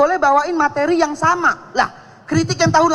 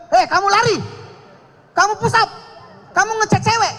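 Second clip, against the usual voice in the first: higher-pitched mock drill commands; lightly voiced police instructor; 4 semitones higher than usual. Police instructor giving trainees orders, mock commanding. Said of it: "ada di S O P pendidikannya".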